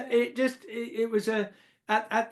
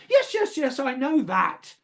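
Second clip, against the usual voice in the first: very high, quick "yes, yes, yes", then the question again; imitating Mother waving off his answer, up high and insistent; 6 semitones higher than usual. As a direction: brush the answer aside and press the question. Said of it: "she said".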